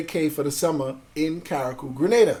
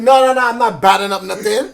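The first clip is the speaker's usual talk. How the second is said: put-on loud, higher Caribbean-accented retort; Caribbean accent on request; 5 semitones higher, 7 dB louder than usual. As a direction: argue back in Caribbean accent, amused. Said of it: "say it in that accent".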